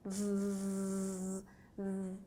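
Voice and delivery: v, v said as a soft long voiced buzz; buzzing v sound. Shown as a demonstration. Teacher showing the V sound to students. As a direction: buzz the v quietly. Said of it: "o som do V".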